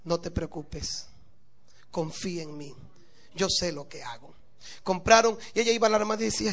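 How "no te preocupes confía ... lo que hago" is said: calm her fears gently, almost whispering; calm reassurance. Acted. Husband's calm reassuring refrain.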